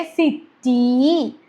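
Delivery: സിറ്റി very slowly, the final ee held long; long ee demo. Shown as a demonstration. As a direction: hold the last ee of സിറ്റി. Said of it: "നീട്ടുന്ന ഇ ആണ് വരുന്നത്".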